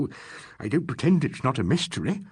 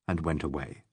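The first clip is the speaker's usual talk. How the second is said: ordinary delivery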